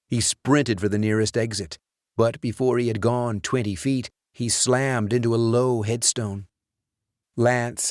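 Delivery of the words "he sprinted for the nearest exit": usual speaking voice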